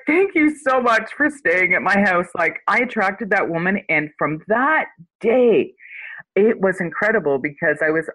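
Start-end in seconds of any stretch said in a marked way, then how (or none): 0.1-2.3 gushing thanks. Retold or imitated. Grateful retold thanks, high voice.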